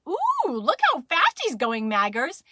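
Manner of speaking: high, loud cooing exclamation; amazed Mama. Acted. Mama shows Maggers her running brother, amazed. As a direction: ooh and marvel for a small child. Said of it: "And Mama said".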